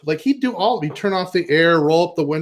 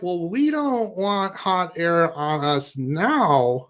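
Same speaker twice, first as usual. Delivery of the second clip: slow deadpan retort; 4 dB quieter, much slower than usual. Retold or imitated. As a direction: drag it out dryly. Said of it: "we're like".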